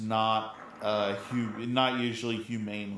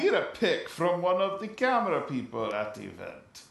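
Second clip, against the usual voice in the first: higher-pitched attempt at a Russian accent; mock Russian accent, pitched up; 7 semitones higher than usual. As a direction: put on an amateur Russian accent. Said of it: "Read it in a Russian accent".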